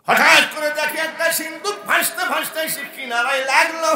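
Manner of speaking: high, loud dramatic announcement; full-force preaching. Acted. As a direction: proclaim the sudden arrival at the top of your voice. (উঁচু স্বরে নাটকীয় ওয়াজ)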